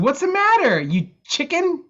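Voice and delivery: high-pitched teasing dare; playful taunt. Acted. Tamales to the bartender who refuses service, playful taunt. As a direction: goad them high, slowing on the last words.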